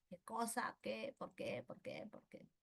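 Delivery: whispered questions that fade to nothing; fading whisper. Shown as a demonstration. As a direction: murmur like a nagging doubt. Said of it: "carcomiendo el cerebro". (susurro que se apaga)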